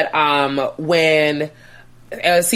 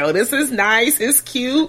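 cooing; 10 semitones higher, slower than usual. Retold or imitated. Gushing reaction to the date.

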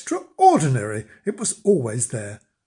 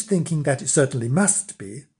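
usual speaking voice

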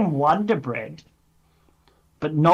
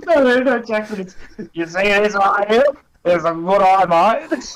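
high, loud mock spread commercial; hammed-up ad voice; 5 semitones higher, 5 dB louder than usual. Mock Vegemite ad to Jackson, loud ad voice. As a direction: sell good eye mate like a jingle.

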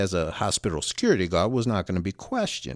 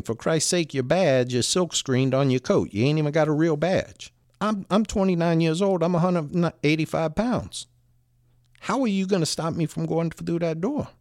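higher-pitched, incredulous retold argument; retelling an exasperated put-down; exasperated challenge; 6 semitones higher than usual. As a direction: challenge the guard's authority, mildly worked up. Retold confrontation, sizing up the guard.

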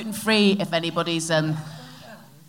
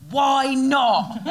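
playful challenge; 4 semitones higher than usual. Retold or imitated. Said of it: "very funnily went".

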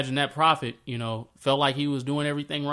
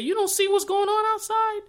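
much higher, indignant prophet voice; Elijah, exasperated; 3 dB louder than usual. Retold or imitated. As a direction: squeak it out like a fed-up prophet. Elijah answers God, retold.

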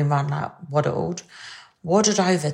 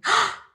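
gasp. Real, not acted. Gasps at listener's happy news.

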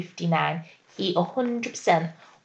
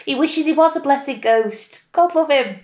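put-on Cockney accent; 7 semitones higher than usual. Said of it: "your Cockney accent".

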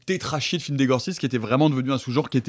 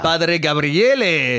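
mock Italian priest; much slower than usual.

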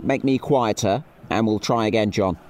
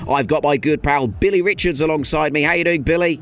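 bright on-air welcome, louder; broadcast presenter; 7 semitones higher, 5 dB louder than usual. Acted. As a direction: launch the live show. Live broadcast opening.

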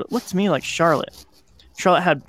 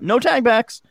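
high-pitched mild yell, not full volume; narrated yell; 9 semitones higher, 3 dB louder, slower than usual. A narrator's reading. Boy running off into the dark, yelling. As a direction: call it out high like a game shout. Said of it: "yelling about".

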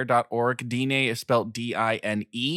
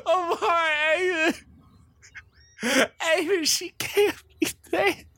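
high-pitched sobbing plea; tearful desperate pleading. Acted. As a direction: beg through tears for someone to save her. Begging healer to save dead friend.

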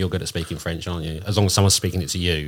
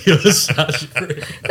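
slow, drawn-out, laughing through each word; laughing, mock-offended jab at a friend; laughing insult; 7 semitones higher, 5 dB louder, much slower than usual. Real, not acted. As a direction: tease her fondly while cracking up. Affectionate insult while laughing.

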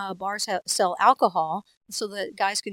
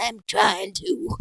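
put-on old person voice; 4 dB louder, much slower than usual. Answering as slow old person.

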